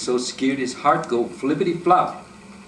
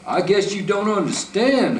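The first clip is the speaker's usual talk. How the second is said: high, loud holler; hollering bear; 9 semitones higher, 5 dB louder than usual. A narrator's reading. Bear to the rabbit, a loud holler. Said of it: "hollered Brer Bear".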